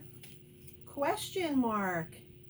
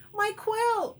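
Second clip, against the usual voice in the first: high, loud cry of alarm; 11 semitones higher, 7 dB louder than usual. A narrator's reading. Quail whose quilt was grabbed by dog, crying out. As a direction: cry out after a stolen treasure. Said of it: "cries Quincy".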